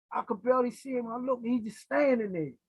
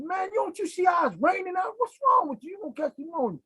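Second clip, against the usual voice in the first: raised, high-pitched scold of a stubborn friend; exasperated yell; 9 semitones higher than usual. Retold or imitated. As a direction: shout at him to get out of the rain. Yelling at friend standing in rain.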